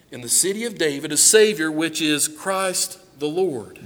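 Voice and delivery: low, soft, measured Bible reading; scripture reading. Acted. Preacher reading Luke to his congregation, soft and low. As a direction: read it gently to the church.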